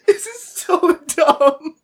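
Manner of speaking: high, slow words through tearful laughter; crying with laughter. Real, not acted. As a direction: barely get it out for laughing. Cannot stop laughing.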